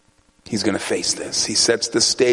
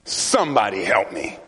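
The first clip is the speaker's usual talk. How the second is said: ordinary talk, nothing marked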